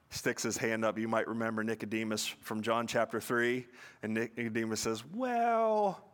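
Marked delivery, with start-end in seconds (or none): 5.1-6.0 high, drawn-out tentative "well"; hesitant objection. Retold or imitated. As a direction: mimic someone cautiously piping up, pitched high. Nicodemus timidly objecting, acted.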